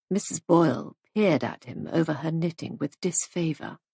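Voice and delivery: usual speaking voice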